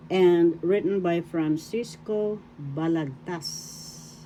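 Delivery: ordinary delivery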